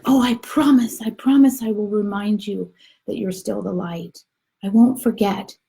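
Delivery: put-on high, earnest childlike vow; promising little soul. Little soul promises the helper, earnest and high. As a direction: pledge it eagerly in a little high voice. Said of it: "so he's like".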